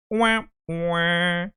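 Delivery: two drawn-out mock instrument notes, "wah, waaah"; imitated sad organ sting. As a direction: sing out a comic failure sting.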